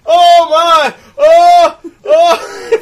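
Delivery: loud, high cries of shock; hammed-up horror; mock horrified wail. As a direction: imitate a woman wailing at a film death. Imitates woman reacting to screen killing.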